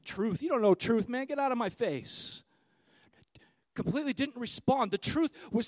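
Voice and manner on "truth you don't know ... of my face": scoffing outburst, loud on the Pfft, then dismissive; angry retort. Retold or imitated. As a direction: brush him off in contempt. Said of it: "meaning he said it with anger".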